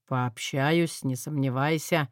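a hint of a stern lord; firm dragon lord. Dragon vows firmly.

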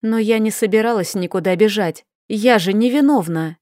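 usual speaking voice

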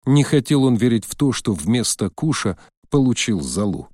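ordinary delivery

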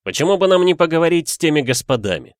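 high, loud, quick suggestion; calling out. A narrator's reading. Detective suggests talking, raised voice. (быстро, громко и высоко)

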